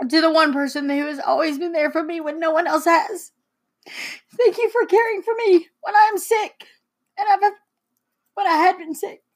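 real tearful, high-pitched voice; trying not to cry. Real, not acted. Daughter thanking Mom for care when sick, near tears. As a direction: fight back tears while reading thanks. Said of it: "I'm trying not to cry".

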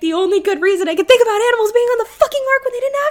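high, loud and fast ranting; excited rant. Real, not acted. Friend ranting about animals on the ark, fast and loud. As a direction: blurt it out in a rush of outrage.

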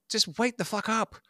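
high-pitched exasperated plea; incredulous, despairing appeal. Acted. Imagined exasperated plea to the founders.

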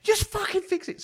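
high loud exasperated yell; chef's bark. Retold or imitated. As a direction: snap the order. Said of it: "He's like".

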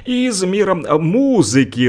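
ordinary talk, nothing marked

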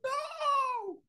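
very high, soft squeaky Kermit cry; Kermit the Frog no. Retold or imitated. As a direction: squeak out a tiny Kermit wail. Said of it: "Kermit the Frog moment".

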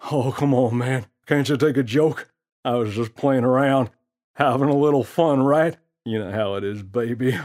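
put-on smooth-talking prankster teasing the kid; gorilla's voice. Gorilla smirks and teases.

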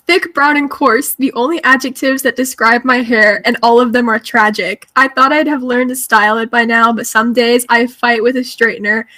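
reading the book quote in a lightly dramatic voice; reading the passage. Shown as a demonstration. Reading her highlighted passage to friends, lightly dramatic. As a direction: read it out, a touch theatrical. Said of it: "So it says".

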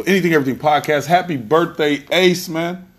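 loud, fast hype-man sign-off; hype outro. Real, not acted. Host hyping his listeners for a birthday shoutout, loud and excited. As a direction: pump up the crowd on the way out.

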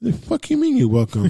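high, indignant squawk; mock outrage. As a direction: play up being offended.

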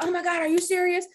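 a hint of shocked client; mock shocked client. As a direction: imitate clients stunned by the news. Clients reacting to retirement news.

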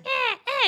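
high, loud fake baby wail; whimpering toy baby noise; imitated baby doll cry. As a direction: mimic a crying doll. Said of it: "weird baby dolls crying".

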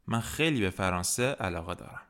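ordinary talk, nothing marked

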